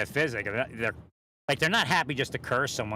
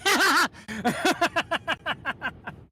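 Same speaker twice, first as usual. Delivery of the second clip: shrieking cackle; high mocking laugh; 5 dB louder than usual. Laugh after mocking quote.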